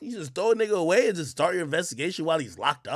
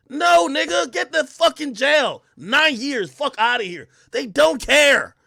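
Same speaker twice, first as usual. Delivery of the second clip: higher, louder furious rant; heated rant, much higher and louder; ranting shout; 10 semitones higher, 8 dB louder, slower than usual. Real, not acted. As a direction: yell it at the chat in outrage. Ranting about foreign jails.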